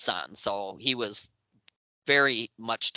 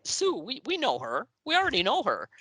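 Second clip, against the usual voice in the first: AC/DC guys amused; 10 semitones higher than usual. Retold or imitated. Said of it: "when they go".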